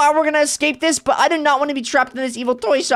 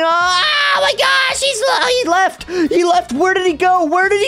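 scared cry; 5 semitones higher than usual. Real, not acted. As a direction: cry out in real fright. Puppet vanished, boy frightened.

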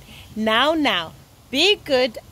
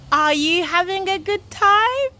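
higher, eager question; rousing repeat question; 5 semitones higher than usual. Real, not acted. Presenter asking young viewers about their holidays, rousing.

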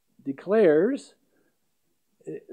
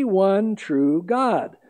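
"one true God" slow, each word weighted; emphatic; much slower than usual. Shown as a demonstration. Preacher stressing there is only one true God. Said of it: "emphatically stating".

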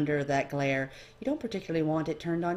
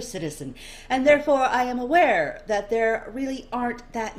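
higher, louder, theatrical probing; curious nobleman; 6 semitones higher, 5 dB louder than usual. Acted. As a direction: show off your citizenship, then pry. Dorian probing.

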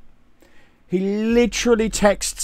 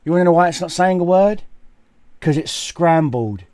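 ordinary delivery

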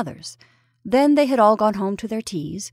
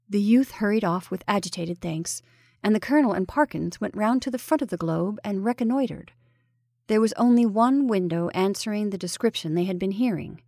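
ordinary delivery, nothing marked

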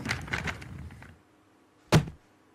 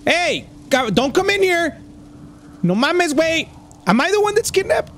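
startled panic; 10 semitones higher than usual. Real, not acted. Panicked reaction to game scene.

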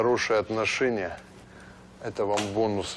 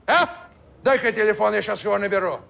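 stern officer voice; 9 semitones higher, 6 dB louder, faster than usual. Acted. Kaban poses as a major.

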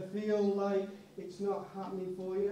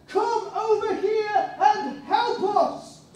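high, slow, loud far-off call; calling for help; 11 semitones higher, 10 dB louder, much slower than usual. Acted. Man from Macedonia calling Paul across the sea, high and slow. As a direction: plead across the sea, drawn out.

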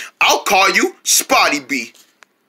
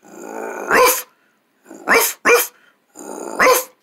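high, breathy little puppy yaps; puppy bark; 13 semitones higher, 4 dB quieter, much slower than usual. Acted. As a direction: yip like a small puppy. Puppy voiced barking.

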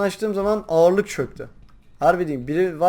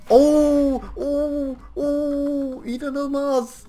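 much higher, drawn-out showman cries, slowing at the end; Survivor host voice; 9 semitones higher, much slower than usual. Pretend. Gamer hyping his own play like a Survivor host. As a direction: hype it like a TV competition host. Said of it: "Oradaki sunucu moduna girdim".

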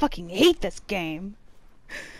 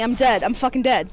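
normal talking voice

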